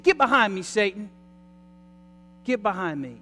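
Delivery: loud sharp rebuke; stern rebuke. Acted. Jesus rebuking Peter for tempting him, loud and stern. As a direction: slam the door on the tempter.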